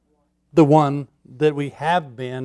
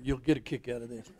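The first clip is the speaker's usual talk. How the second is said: softer, faster muttered repeat; quick quiet aside; 6 semitones lower, 15 dB quieter, faster than usual. Real, not acted. Pastor teasing the congregation, quick soft aside. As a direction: toss it off under your breath, quickly.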